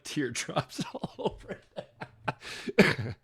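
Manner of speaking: slow, laughing at her own teardrops; a real laugh catching on the words; laughing through tears. Real, not acted. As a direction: let a teary laugh break the words.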